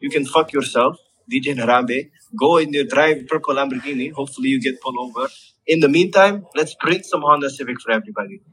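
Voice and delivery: ordinary delivery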